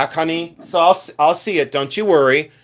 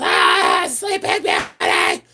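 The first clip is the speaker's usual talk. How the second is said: mock scream; 8 semitones lower, much slower than usual. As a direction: scream first, then squeal the name out. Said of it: "they all yell the same thing".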